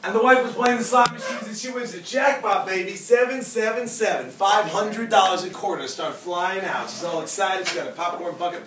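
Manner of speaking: high, loud, excited retelling; jackpot excitement. Retold or imitated. Speaker tells class of the wife winning the jackpot, excited. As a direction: build the thrill of hitting the jackpot.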